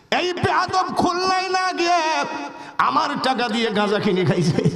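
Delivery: high-pitched indignant outburst; mock outrage. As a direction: complain in a high, scandalised voice. Acted outrage at the cheat.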